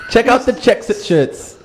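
mock-English advert shout, drawn out; English accent. Shown as a demonstration. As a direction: sell it in a badly faked English accent. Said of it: "in your English accent".

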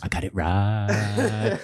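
hugely drawn-out wrestling hype call; slow, triumphant wrestler voice; wrestler entrance. Acted. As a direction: stretch it out like a wrestler entering the ring. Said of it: "one of those wrestlers entering the ring".

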